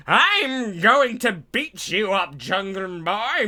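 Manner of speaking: hammed-up old-timer lecture; old man. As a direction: croak it out like an ancient wrestler. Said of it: "drops a microphone going".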